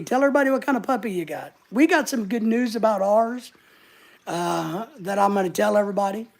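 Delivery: normal talking voice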